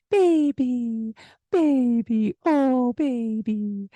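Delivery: high, slow crooning; baby talk. Shown as a demonstration. Teacher demoing baby talk for parents, high crooning. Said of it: "this baby talk way that we talk".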